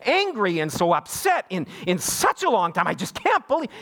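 imitated indignant rant, pitch swooping up; mock an aunt too upset to finish; hammed-up exasperated outrage; worked-up aunt. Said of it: "you just knew her blood pressure".

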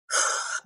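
long breathy exhale; heavy sigh. Real, not acted. Grieving guest thinking of others' losses, real heavy sigh. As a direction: let out a weighted sigh.

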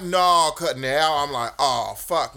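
loud, slow exasperation; exasperated. Retold or imitated. Teller fuming over the extra charge.

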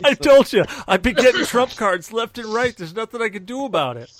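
loud, high exasperated protest; exasperated loud complaint. Real, not acted.

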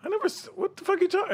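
high, fast, exasperated retort; baffled protest. Retold or imitated. Answering an angry friend's accusation, high rapid disbelief. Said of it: "I go".

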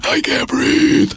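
high, slow, strangled wheeze; gasping daughter. Retold or imitated. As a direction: squeeze the words out like a kid short of air. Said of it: "my daughter is sitting on the bed going".

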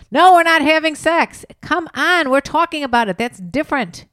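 high, loud, exasperated protest; emphatic declaration. Pretend. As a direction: proclaim it loudly, fed up with being asked. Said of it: "I wanna make a declaration".

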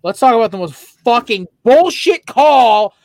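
loud, high, outraged opener; furious rant. Real, not acted. Host ranting to co-host about the call, furious and loud. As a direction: blow up about the worst call.

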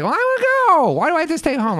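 loud, very high mock wail; hammed-up kid protest at being left out; whining little sister. Sister protests to her brother, whiny wail.

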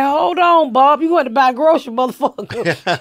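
high and loud, teasing through a laugh; mock scolding tease. Host teasing the guest about his third plate, mock outraged. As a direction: rib him about the food, playfully outraged. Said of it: "I was like".

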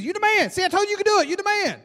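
high, loud excited hollering; cheering dad. Acted. Dad cheering his son round the ride, exuberant. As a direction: whoop it out to a kid riding past.

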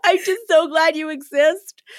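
choked-up thanks to a friend, laughing and tearful; teary gushing. Real, not acted. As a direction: gush through happy tears. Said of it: "Maybe I am emotional".

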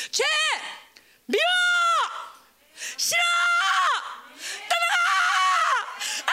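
high screamed outbursts with long pauses; drawn-out imitation scream; imitated preacher's shouting. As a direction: scream each word out, mimicking an anguished preacher. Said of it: "김인종 목사님이 설교할 때 그랬지".

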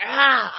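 a short loud "Ah" jumping out of hiding; a hammed-up werewolf roar to scare her; mock monster roar. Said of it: "I jump out and I'm like".

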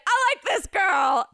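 put-on booming, slow old-man quote; loud old man voice. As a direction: belt it out like a delighted old man. Older man at checkout, retold.

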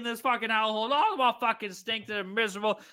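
ordinary delivery

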